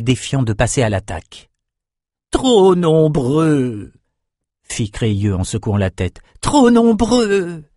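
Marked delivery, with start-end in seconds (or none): 2.3-3.9 high, very drawn-out cry; wailing shaman. A narrator's reading. Shaman shakes his head at the enemy, wailing. 6.4-7.6 very high, drawn-out wail; shrieking shaman. A narrator's reading. Shaman shakes his head at the enemy, wailing high.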